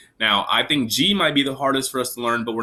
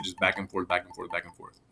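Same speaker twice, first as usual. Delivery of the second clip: softer and softer, trailing away; fading repetition; 4 semitones lower, 8 dB quieter than usual. Shown as a demonstration. Teacher showing finger practice, fading back and forth. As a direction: let it fade as you repeat. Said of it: "a good way to practice it".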